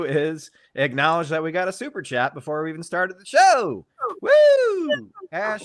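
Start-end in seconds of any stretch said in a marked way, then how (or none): 4.2-5.1 high excited whoop; cheering woo. Real, not acted. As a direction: let out a big woo. Cheer for super chat.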